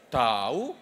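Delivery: "tahu" drawn out, dipping then rising; doubtful intonation. Shown as a demonstration. Answering where Ani is, doubtful dip-and-rise on "tahu". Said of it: "Intonasi meragukan".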